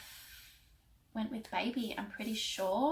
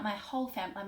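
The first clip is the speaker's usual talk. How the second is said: normal talking voice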